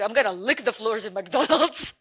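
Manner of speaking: giggling through a silly made-up plan; laughing. Retold or imitated. Telling a friend his absurd choice, laughing. Joking quote of own reaction.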